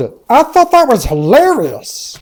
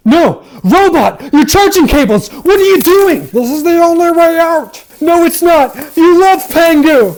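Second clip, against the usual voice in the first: shouting; 6 semitones higher than usual. Acted. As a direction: yell in alarm at the robot. Shouting argument with robot.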